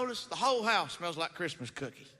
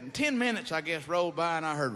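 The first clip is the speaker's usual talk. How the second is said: normal talking voice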